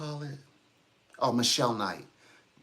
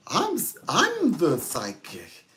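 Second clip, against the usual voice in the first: South Park; 10 semitones higher, 3 dB louder than usual. Acted. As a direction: put on a high cartoon voice, rising to a squeal. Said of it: "Give a little bit of South Park".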